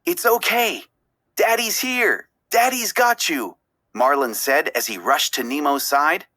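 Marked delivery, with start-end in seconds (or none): 0.0-3.6 comforting. A narrator's reading. Marlin comforts trapped son.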